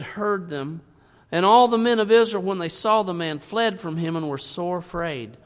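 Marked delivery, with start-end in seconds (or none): 1.3-4.2 loud. Real, not acted. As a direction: read out with a sudden loud burst. Preacher reading scripture loudly.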